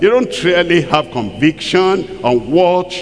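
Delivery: ordinary delivery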